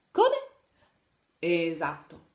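ordinary delivery

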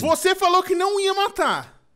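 loud, high, drawn-out accusation; indignant shout. Real, not acted. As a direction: shout out the betrayal, slowly. Acusa colega de traição no jogo. (grito indignado e agudo)

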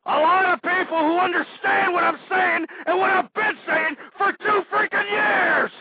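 loud and high, slowing at the end; angry shout. Acted. Host yelling about two years of shows. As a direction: hammer out the grievance. Ranting about his long campaign.

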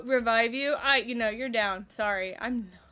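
ordinary talk

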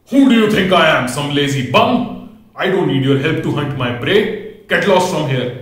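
high, loud roaring lion in a rage; furious lion yelling at the animals; angry lion. A narrator's reading. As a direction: bellow it like an offended king of beasts. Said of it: "the lion got really angry".

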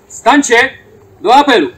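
loud ceremonial call to stand. Real, not acted. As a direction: call the fallen to roll call. Ceremonial roll call summons.

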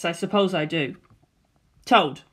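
normal talking voice

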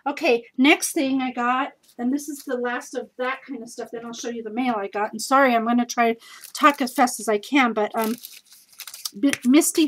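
normal talking voice

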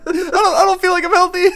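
high, loud nervous Morty impression; squeaky stammering Morty voice. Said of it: "the chow doctor is Rick".

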